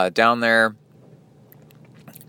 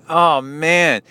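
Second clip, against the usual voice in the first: regretful groan; 7 semitones higher, 4 dB louder than usual. Retold or imitated. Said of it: "And I was like".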